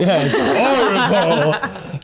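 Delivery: high, drawn-out joke through laughter; laughing. Real, not acted. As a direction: play along with the joke, laughing. Joking along that touring is horrible.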